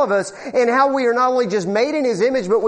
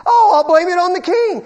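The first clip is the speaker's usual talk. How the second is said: mocking whine; 8 semitones higher, 6 dB louder than usual. Mocking people who blame others.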